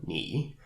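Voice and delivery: "ni" said on a low dipping tone; third-tone "ni", deep and low. Shown as a demonstration. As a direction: drop "ni" low in the throat. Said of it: "we could have the third tone".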